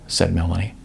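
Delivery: usual speaking voice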